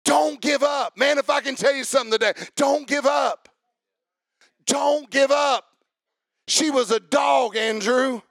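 high, loud, driving sermon delivery; impassioned preacher building in intensity; full-force preaching. Real, not acted. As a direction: preach it hard to the congregation.

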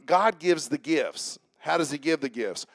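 normal talking voice

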